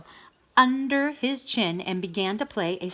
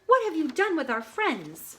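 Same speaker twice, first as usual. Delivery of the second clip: high, alarmed demand; Dog confronting Wolf about missing friends, alarmed; 9 semitones higher than usual. Acted.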